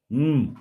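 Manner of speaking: loud appreciative hum while tasting; savouring mm. Real, not acted. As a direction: hum with pleasure over the taste. Tasting butter.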